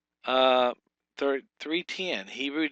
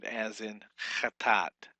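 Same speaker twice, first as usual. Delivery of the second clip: normal talking voice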